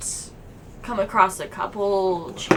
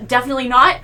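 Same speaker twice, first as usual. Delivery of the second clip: emphatic quote; 3 semitones higher, 9 dB louder, much faster than usual. Retold or imitated. Omen's blunt answer, quoted.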